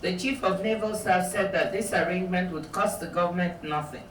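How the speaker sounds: calm, even reading from a memoir; measured author reading; book reading. Acted. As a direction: read the passage steadily to the audience. Reads aloud from her book.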